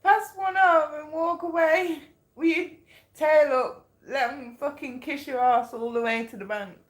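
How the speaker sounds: high, loud, giddy delivery; laughing fit. Real, not acted. Reader joking about the skunk's advice mid-fit, laughing. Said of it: "I don't know why I'm laughing".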